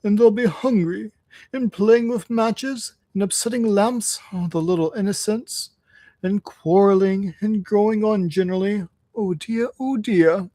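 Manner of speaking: high, pitiful washerwoman act; hammed-up plaintive Toad; mock sobbing. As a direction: lament in a put-on weepy voice. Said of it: "sobbed Toad".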